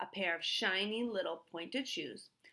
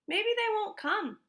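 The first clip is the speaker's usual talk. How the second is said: put-on much higher, louder boy's voice; hoping out loud, a young boy's fret; Stanley's worried thought; 13 semitones higher, 7 dB louder than usual. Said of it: "Stanley thought".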